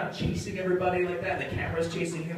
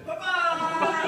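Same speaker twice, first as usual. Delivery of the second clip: high yelping pop-star cry with a laugh; a hint of a pop-star squeal on "Papa!"; 11 semitones higher than usual. Showing the audience how the yell sounded.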